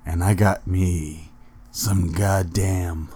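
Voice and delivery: put-on slow, halting drunk uncle voice. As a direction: play a boozy uncle with long, drawn-out words and pauses. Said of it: "It's your drunk ass Uncle Bobby".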